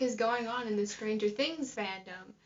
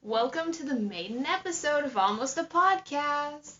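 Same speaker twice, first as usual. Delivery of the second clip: put-on podcast host welcome, higher and swooping; lilting, raised podcast-intro voice; 4 semitones higher than usual. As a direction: announce it like an overeager podcast host. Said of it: "trying to get a podcasting voice".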